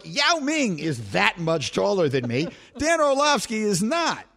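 normal talking voice

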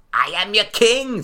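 put-on high, slow, snotty boy king; Joffrey impression. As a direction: play the bratty boy king, badly. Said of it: "that was a horrendous Joffrey impression".

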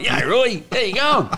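high, loud, cheeky hello; cheeky greeting. Retold or imitated. Teen heckling the coach, cheeky high greeting. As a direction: chirp it out like a cheeky kid. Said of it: "even in that tone".

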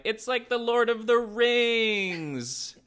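high, drawn-out mock wonder; awestruck exclamation. Kids running into a huge neglected mansion, awestruck exclamation. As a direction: imitate amazed kids, stretching it out high.

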